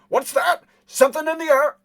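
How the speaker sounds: high, loud cry of alarm; alarmed yell. Acted. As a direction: yell out a startled warning. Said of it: "you hear a voice yell".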